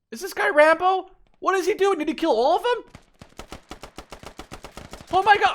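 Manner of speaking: hyped up, cheering on a lone soldier; excited amazement. Real, not acted. Commentator thrilled as one soldier takes out a squad, high and loud.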